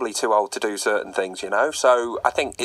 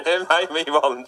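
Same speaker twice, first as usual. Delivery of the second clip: high laugh through the words; breaking into laughter; 7 semitones higher, 4 dB louder than usual. Real, not acted. Laughing at veteran footballer remark.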